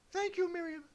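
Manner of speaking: very high, sweet little pony voice; squeaky Twilight. Acted. Twilight answers the wizard in a very high, happy squeak. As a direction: chirp it like a toy pony.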